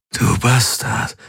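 slow, rasping triumph; triumphant croak. A narrator's reading. Exhausted man croaks triumphantly at his defeated foe. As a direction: croak the insult in victory. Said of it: "krächzte er triumphierend".

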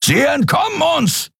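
very high, frantic commanding cry; urgent shout. A narrator's reading. Captain sees the fleeing ship gaining, urgent shout. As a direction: shout as the prey slips away. Captain losing his quarry.

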